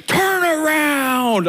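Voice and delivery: slow, high mock yell of an angry stranger; imitating a furious roadside stranger yelling; angry shout. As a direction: bellow it like a furious man on the curb. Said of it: "like, angry".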